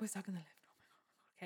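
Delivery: panicked whisper under the breath; spanikowany szept. Retold or imitated. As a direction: whisper it, keeping the panic hidden. Hiding panic from colleague.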